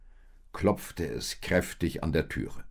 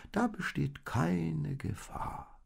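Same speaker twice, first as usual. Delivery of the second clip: reassuring sheep; 12 semitones higher, slower than usual. Acted.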